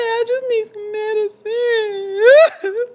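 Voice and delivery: high, slow, wailed plea; mock crying like baby. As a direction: bawl it like a baby, hammed up. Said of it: "I was crying like a baby".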